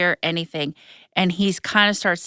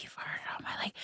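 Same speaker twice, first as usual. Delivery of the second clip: barely audible whispered confession; mimicked whisper; 20 dB quieter than usual. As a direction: whisper it, imitating someone you can't hear. Mimics boyfriend she couldn't hear.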